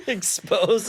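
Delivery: high, loud word through laughter; laughing. Real, not acted. Friend admitting he felt exposed in spandex, laughing.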